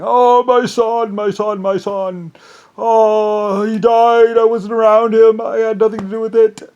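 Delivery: high, slow, hammed-up wail of grief; mock grieving Lincoln. Mocking Lincoln wailing over his dead son. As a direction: ham up a wailing father who denies everything.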